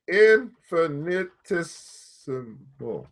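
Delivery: Infinitesimal said very slowly, syllable by syllable; sounding it out. Shown as a demonstration. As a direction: stretch Infinitesimal out carefully. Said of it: "Let me see".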